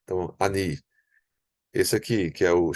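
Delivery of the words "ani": ani with the A short and light; unstressed A. Shown as a demonstration. Teacher summing up for learners, ani with a quick, unstressed A. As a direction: skim the A of ani and land on NI. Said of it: "é, mais átono".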